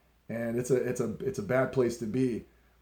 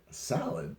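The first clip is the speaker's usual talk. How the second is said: softer, mock-puzzled echo; retold disbelief; 8 dB quieter than usual. Fan hears his idol is getting a salad, quietly incredulous.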